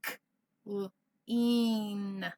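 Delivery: "k, l, een" pulled apart sound by sound; segmented sounds. Shown as a demonstration. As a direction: split clean into its sounds.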